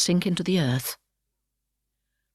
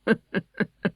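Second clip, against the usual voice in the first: gleeful griffin chuckle; griffin's chuckle. Acted. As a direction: chuckle to yourself like a pleased beast. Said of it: "Then it chuckled".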